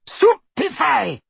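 high, drawn-out screamed spell. A narrator's reading. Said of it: "she screamed".

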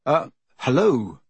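slow, hesitant greeting; a touch of nerves, drawn out; nervous. A narrator's reading. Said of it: "said Harry nervously".

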